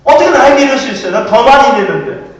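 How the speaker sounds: loud, aggrieved complaint; indignant protest. Acted. Preacher voices a worker wronged over wages, indignant protest.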